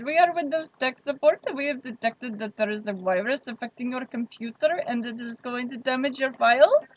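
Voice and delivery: put-on scam caller with an Indian accent; imitated Indian accent. Scammer warning of a computer virus, Indian accent. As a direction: mimic a scripted Indian-accented tech support call. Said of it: "this guy called my phone".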